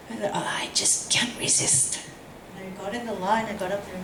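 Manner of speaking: hushed, breathy aside as he sneaks into line; conspiratorial whisper. Retold or imitated. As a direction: whisper it like a confession to the room. Sneaking up to mock the rally.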